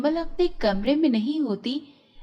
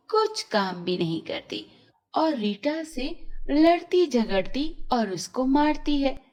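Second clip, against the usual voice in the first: usual speaking voice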